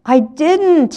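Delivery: very high protesting wail. A narrator's reading. Bertie defending himself against Olive's accusation, wailing. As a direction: wail it in childish self-defence. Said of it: "he wailed".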